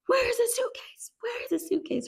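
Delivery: very high, strained, airy squeal; frantic demand. Retold or imitated. Rue demands the suitcase from Mom, frantic and high. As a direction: imitate a panicked, repeated demand.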